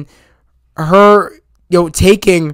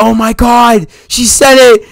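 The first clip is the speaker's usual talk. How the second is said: higher, excited crowd reaction; amazed audience; 4 semitones higher, 4 dB louder than usual. Retold or imitated. Whole audience reacting to the famous line, amazed. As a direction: exclaim it like a thrilled moviegoer. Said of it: "The whole audience was like".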